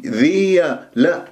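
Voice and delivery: ordinary talk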